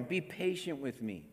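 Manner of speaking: hushed plea. A narrator's reading. Servant kneeling before his master, quiet plea. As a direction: whisper the plea on his knees. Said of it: "the servant fell on his knees".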